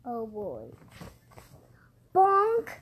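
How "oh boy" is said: low, soft cool voice. Pretend. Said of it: "I'm making it in a cool voice".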